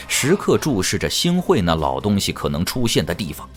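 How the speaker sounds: usual speaking voice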